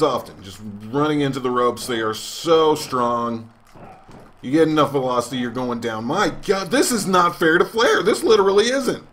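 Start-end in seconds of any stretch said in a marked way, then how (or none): 6.0-9.1 pitched-up commentator protest, rising on Flair; higher announcer outrage. Acted. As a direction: cry foul like an excited announcer.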